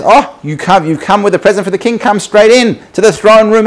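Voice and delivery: high, eager, loud welcome; excited guard. Acted. Royal guard admitting a gift-bearer to the king, excited. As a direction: usher them in with lifted, eager voice. King's guard ushers fisherman in.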